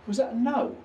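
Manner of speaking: high, loud question; calling out. Real, not acted. Investigator checks a spirit's answer, calling out high. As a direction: throw the question out to someone unseen.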